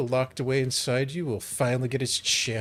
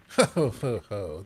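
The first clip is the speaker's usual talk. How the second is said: mocking laugh. Villain mocks the angry girl.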